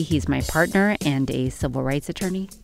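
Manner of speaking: usual speaking voice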